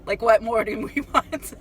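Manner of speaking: amused disbelief with a laugh. Retold or imitated. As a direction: laugh breaking into the words. Repeats it, breaking into laughter.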